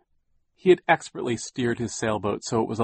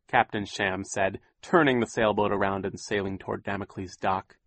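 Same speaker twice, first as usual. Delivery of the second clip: ordinary delivery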